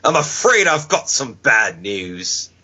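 slow, drawn-out catchphrase impression; put-on voice, stretching out the announcement; Wade Barrett. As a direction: imitate a wrestler delivering his catchphrase. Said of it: "ended the relationship by saying".

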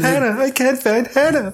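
high-pitched mimicry of a panicked film line; imitating someone fretting over a lost friend; mock distressed character. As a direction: put on a high, hammed-up searching voice.

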